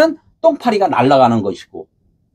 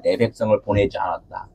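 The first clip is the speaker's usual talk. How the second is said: low quiet echo; 10 semitones lower, 9 dB quieter than usual. Shown as a demonstration.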